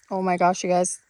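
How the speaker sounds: worn out from coughing; just swallowed a bug. Real, not acted. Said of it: "That's what went down my throat".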